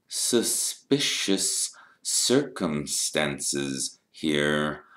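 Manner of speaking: slow, deliberate interrogation with stretched words; insinuating Snape. A narrator's reading. Snape questioning why Harry missed the feast, slow and insinuating. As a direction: build suspicion word by word.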